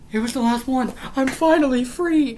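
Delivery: high, loud cry of release; overjoyed relief. Real, not acted. Reader finally done with joke book, overjoyed high shouting.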